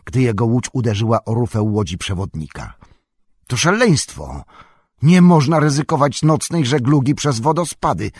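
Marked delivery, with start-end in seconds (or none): 3.5-8.1 raised, alarmed protest; Boromir. A narrator's reading. Boromir protesting to the guide about the rapids, shouting. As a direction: object loudly, pitched higher. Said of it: "krzyknął Boromir".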